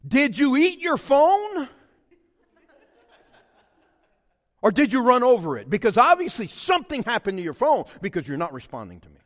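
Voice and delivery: loud, high mock-outraged rant; exasperated dad. Dad scolding the kid who ignores his texts, exasperated.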